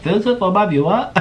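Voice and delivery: high, exaggerated mock British accent; bad British accent. Vlogger redoing Orlin's line for fun, very bad British accent. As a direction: overdo a terrible British impression. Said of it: "very bad impersonation of a British".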